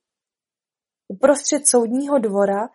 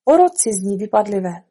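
usual speaking voice

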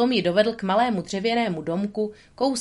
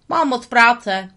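loud, slow, higher beaver grumble; a busy critter who won't look up; 4 semitones higher, 8 dB louder, slower than usual. Acted. The beaver brushes Tramp off without looking up.

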